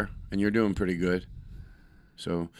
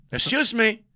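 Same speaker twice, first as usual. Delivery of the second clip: indignant guard; 11 semitones higher than usual. Retold or imitated. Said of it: "he would just say it".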